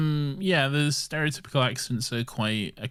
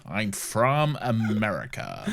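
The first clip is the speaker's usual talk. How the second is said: mock American accent; 2 semitones lower than usual. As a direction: drag out the caricature American. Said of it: "Doctor Strange does his American accent".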